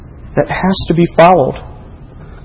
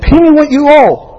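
much higher, louder furious demand; angry demand; 12 semitones higher, 6 dB louder than usual. Acted. Unforgiving servant demanding payment, furious. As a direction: seize him by the throat with words.

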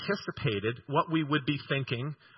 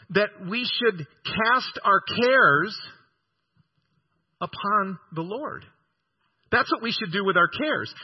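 emphatic preaching; 6 semitones higher, 4 dB louder than usual. Acted. Preacher builds to emphatic point.

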